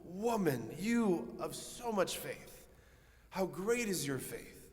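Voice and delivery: high, slow exclamation of wonder; impassioned, lifted cry of praise; Jesus. Acted. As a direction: marvel at her faith, drawing out the words. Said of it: "it's the O of Jesus".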